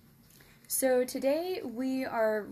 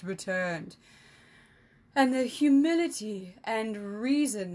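smug emissary speech; 4 dB louder than usual. Acted. Emissary scolding the crowd about the tax, smug and loud. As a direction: speak down to the crowd with silky menace.

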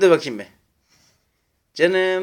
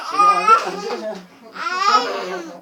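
laughing; faster than usual. Real, not acted. Laughing playful threat at child.

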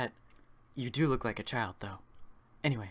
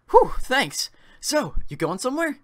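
lightly voiced Paul; 11 semitones higher, 9 dB louder than usual. Voicing Paul.

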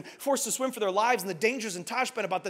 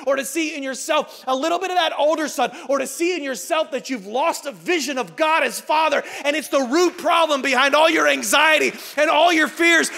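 loud, high, driving sermon shout; impassioned preaching; 7 semitones higher, 8 dB louder than usual. Real, not acted. Preacher challenging the congregation about comparison, impassioned. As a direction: push each question out at full force.

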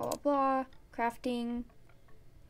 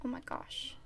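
whispering; 17 dB quieter than usual. Real, not acted. Awed reaction to hardcore.

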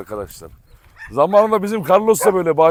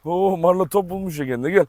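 ordinary talk

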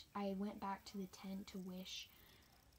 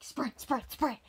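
louder, clipped urging to sprint; a chanted sprint cheer from the sidelines; urgent cheering; 4 semitones higher, 11 dB louder, slower than usual. Retold or imitated. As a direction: push a teammate up the hill, louder. Teammate urging runner to sprint.

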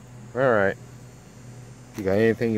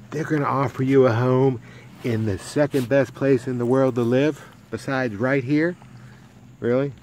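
higher, louder, playful disbelief; playful teasing; 5 semitones higher, 6 dB louder than usual. Real, not acted. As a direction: tease the dog with mock amazement.

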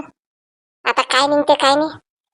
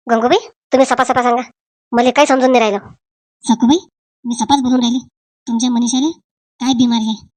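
ordinary delivery

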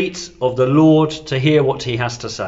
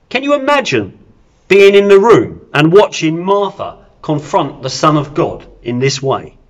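ordinary delivery

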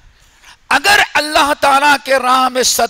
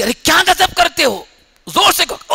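scolding; 6 semitones higher than usual. Retold or imitated. Wife scolding husband.

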